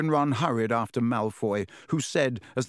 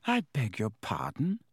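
mildly offended, formal stiffness; lightly voiced stiff schoolgirl; 3 dB quieter than usual. A narrator's reading. Girl approached by a stranger, stiffly taken aback. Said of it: "said the girl stiffly".

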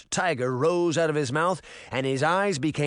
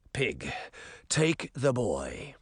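put-on slow, low, contained old man; softer, deliberate and controlled; calm; 3 semitones lower, 5 dB quieter, slower than usual. A narrator's reading. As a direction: give the order quietly and slowly. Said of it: "the old man said calmly".

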